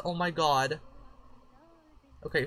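usual speaking voice

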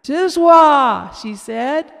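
put-on high, loud pleading child; sad toddler plea. A narrator's reading. Casey growing sadder, high loud toddler plea. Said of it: "She was growing sadder and sadder".

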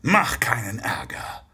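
soft, slow whispered warning; quiet threat. Acted. Santa quietly warns the guard before the fight. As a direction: warn him low and quiet.

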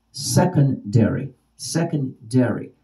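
"Secondary" slowly, split into beats; syllable demonstration. Shown as a demonstration. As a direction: measure out every syllable of "Secondary". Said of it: "how many syllables".

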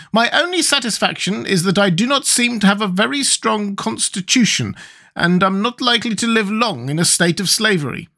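high, squeaky creature resigned to its lot; high-pitched, resigned monster; Gump's sad speech. A narrator's reading. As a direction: squeak it out with mild self-pity. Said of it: "this sad speech".

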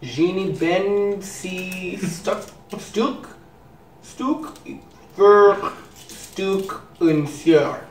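slow, halting German read; sounding out German words syllable by syllable; attempted German. Shown as a demonstration. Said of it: "How was I?".

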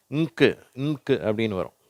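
ordinary talk

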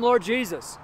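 ordinary talk, nothing marked

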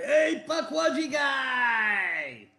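loud, high holler drawn out long; re-enacted far-carrying shout, stretched on the last word; imitated shout. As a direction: call out as if across a room. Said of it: "he shouted".

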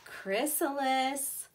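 "Chrysalis" drawn out slowly and clearly; slow vocabulary word. Shown as a demonstration. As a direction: spell out "Chrysalis" with the voice. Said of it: "Look for these words".

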